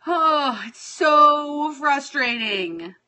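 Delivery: ordinary talk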